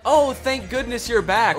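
overjoyed, hammed-up relief; relieved husband. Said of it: "would you be like".

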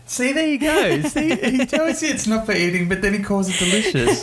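high, laughing through the words; can barely get it out for laughing; laughing while talking. Real, not acted. Laughing about son's poo quote.

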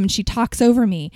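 ordinary talk

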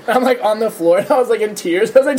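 ordinary talk, nothing marked

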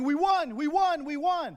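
loud, high joyful yelling; retold victory scream. Boy celebrating the Mets win in his basement, yelling. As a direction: yell it like a ten-year-old fan.

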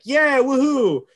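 high, loud cheering whoop; mock cheer. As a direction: cheer it like a fan in the crowd. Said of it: "It's like".

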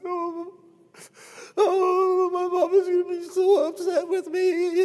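high, whiny fake sobbing; mock crying. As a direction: ham up crocodile tears like a caught criminal. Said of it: "crocodile tears started coming down".

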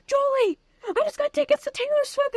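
excited squeal. Retold or imitated. Shouting the news to her neighbor, squealing high. Telling neighbor about the tickets.

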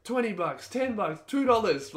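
high-pitched mimicked haggling; desperate bar staff. Bar staff bargain with departing customers, pleading imitation. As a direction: imitate hawkers frantically dropping the price. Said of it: "and they're like".

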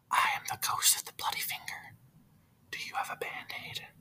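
very soft eerie ghost whisper; deadpan ghost whisper for the punchline; spooky whispered ghost asking for a Band-Aid; ghostly whisper. Acted.

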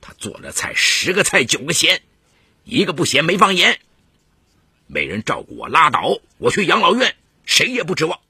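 put-on scornful old husband, high and emphatic; high-pitched, contemptuous grumbling; disdainful. A narrator's reading. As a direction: huff dismissively, building to loud indignation. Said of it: "董传林不屑地说".

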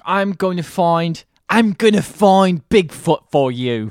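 slow hammed-up Australian accent; bad Australian accent. Mimicking the show host's hammed-up Australian accent. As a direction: drag it out in a terrible Australian accent. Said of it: "I'm doing a perfect accent for him".

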